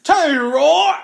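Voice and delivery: high, loud, drawn-out quote; Davey. Acted. Acting out a friend's catchphrase, high loud yell. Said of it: "and then Davey said".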